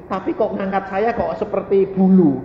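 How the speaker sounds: ordinary talk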